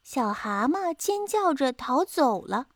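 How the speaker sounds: usual speaking voice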